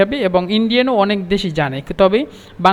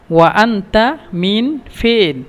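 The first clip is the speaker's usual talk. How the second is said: very slow, careful teaching repeat; drawn-out model question; very slow demo; much slower than usual. Shown as a demonstration. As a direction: stretch every word out for learners.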